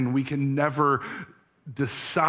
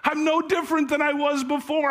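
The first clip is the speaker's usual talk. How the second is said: much higher and faster, the confession breaking upward; husband crying out his failure, higher and quicker; rising tearful despair; 11 semitones higher, faster than usual. Acted. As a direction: repeat it with mounting anguish, pitch climbing. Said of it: "he answered through his tears".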